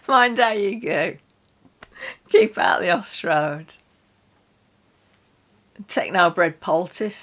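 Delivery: ordinary talk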